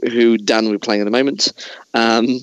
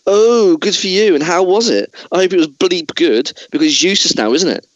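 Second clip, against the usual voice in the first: sarcastic Bale rant; 7 semitones higher than usual. Pretend. Host as Bale mocks the cinematographer with a sarcastic outburst. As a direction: sneer it at him, voice shooting up.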